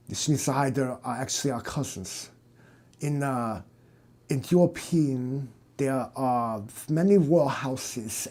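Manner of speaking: a lightly voiced German aristocrat; German accent. German doctor explaining his family, in a German accent. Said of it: "Your stupid accent".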